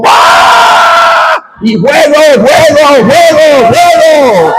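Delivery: high, drawn-out yell, repeated over and over; hammed-up exorcist shout. Imitating deliverance workers casting out a demon, shouted at full pitch. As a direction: build into a long high cry of fire.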